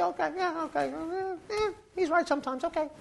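high, reluctant mock agreement; grudging congregant. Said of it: "know me well enough to say".

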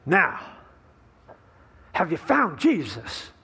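slow, deliberate second asking; slow insistent pastor. Acted. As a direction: drag the question out, pressing him. Pastor asks the drunk again.

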